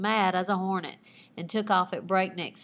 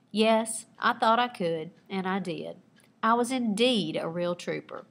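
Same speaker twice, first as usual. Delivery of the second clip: ordinary talk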